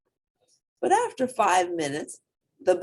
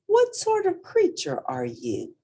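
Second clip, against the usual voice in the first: put-on young hippo voice; 12 semitones higher, 3 dB louder than usual. Portly voiced asking.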